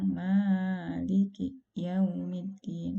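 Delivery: Maliki Yaumiddin slow, vowels held too long; overlong mad demo. Shown as a demonstration. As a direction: draw out Maliki Yaumiddin as an error. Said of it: "itu dibaca menjadi terlalu panjang".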